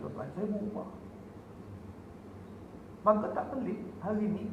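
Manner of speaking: usual speaking voice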